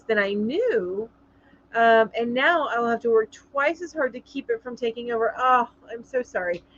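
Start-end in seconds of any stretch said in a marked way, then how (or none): none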